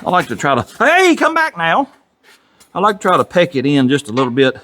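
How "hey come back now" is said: talking to an object. Real, not acted. Talking to a rolling part.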